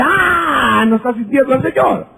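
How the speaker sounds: lightly hammed-up fervent cry; mock emotional cry. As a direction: parody a worshipper crying out. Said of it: "y no dice".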